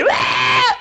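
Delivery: high retold shriek; high wail. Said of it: "Elle se met à hurler". (cri aigu imité)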